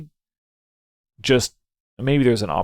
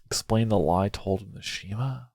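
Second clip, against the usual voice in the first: ordinary talk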